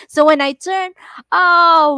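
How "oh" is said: loud, high "oh!" yelp; retold scream. Driver retelling an icy turn with sister-in-law, imitated scream. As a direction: cry out high and loud, reliving a skid. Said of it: "we're both screaming".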